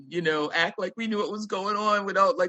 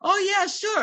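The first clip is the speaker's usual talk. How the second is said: breezy yes; 13 semitones higher than usual. Retold or imitated. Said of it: "And we said".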